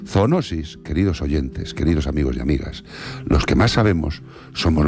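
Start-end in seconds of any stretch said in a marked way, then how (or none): none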